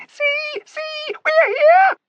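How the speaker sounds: very high squeaky squeals; squealing with joy. A narrator's reading. Little animals arriving, squealing with joy. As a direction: squeak it like a delighted mouse. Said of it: "squealing with joy".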